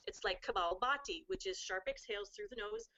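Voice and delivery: ordinary delivery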